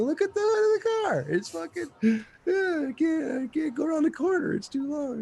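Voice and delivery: very high freaked-out squeal; high panicked voice. Retold or imitated. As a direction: squeal it up high, too stoned to drive. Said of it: "I'm like".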